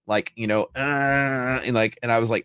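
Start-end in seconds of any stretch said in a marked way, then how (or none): 0.8-1.6 long high moan, mimicked; imitated hurt groan. Streamer imitating someone possibly shot and hurt, groaning. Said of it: "got shot or something and then was hurt".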